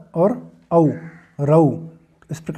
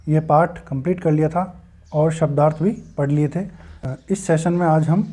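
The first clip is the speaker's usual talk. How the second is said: usual speaking voice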